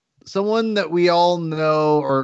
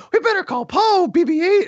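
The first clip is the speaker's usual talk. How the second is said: much higher, cartoonish officer voice; mock First Order officer; 14 semitones higher, 4 dB louder than usual. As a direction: ham up a silly villain giving an order. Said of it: "the First Order will show up and go".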